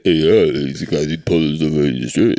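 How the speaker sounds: slurred, garbled action-star impression; Sylvester Stallone. Star popping up at the back, slurred impression. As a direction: mumble it all into mush. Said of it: "Sylvester Stallone pops up".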